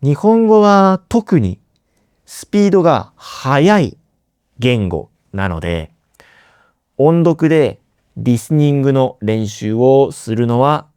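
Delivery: ordinary delivery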